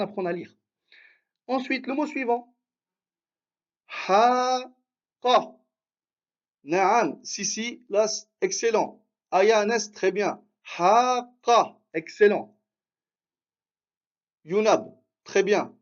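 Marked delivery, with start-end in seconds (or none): 4.1-5.6 "Haqa" with long "Ha", then short "qa"; lengthened first vowel. Shown as a demonstration. Teacher reading the next word, "Haqa" with long first syllable. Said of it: "l'allongement qu'on vient de voir". 10.8-11.8 "Haqa" with held "Ha" and short "qa"; louder, higher "Haqa", long on "Ha"; lengthened first vowel. Shown as a demonstration. Teacher confirming a student's answer, "Haqa" with long "Ha". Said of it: "l'allongement qu'on vient de voir".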